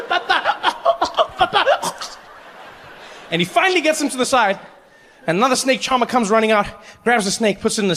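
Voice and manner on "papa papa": put-on choking child.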